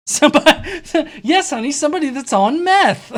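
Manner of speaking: high, loud answer through laughter; amused jab, breaking into laughs; laughing. Real, not acted. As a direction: crack up while mocking. Laughing while answering the clip.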